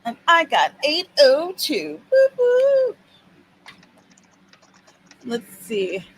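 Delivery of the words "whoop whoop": whoop whoop cheer. Real, not acted. Cheering a word count.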